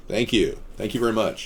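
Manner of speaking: ordinary delivery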